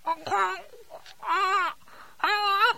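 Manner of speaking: apple stuffed in mouth; mouth full. Real, not acted. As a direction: talk around an apple. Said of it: "stick this apple in your mouth".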